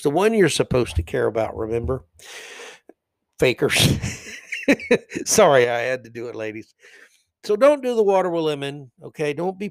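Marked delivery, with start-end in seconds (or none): 4.3-5.2 short breathy laugh. Real, not acted. Laughing after joking at ladies.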